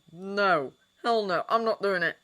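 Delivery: loud, curt growling no; growled refusal. A narrator's reading. Sirius refusing his caller on the phone, loud growl. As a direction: bite off each refusal into the phone. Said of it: "growled Sirius".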